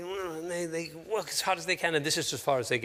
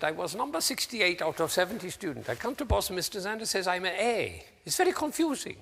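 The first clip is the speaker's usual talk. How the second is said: higher, imitated Taiwanese student's English accent; 5 semitones higher than usual. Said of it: "my attempt at his accent".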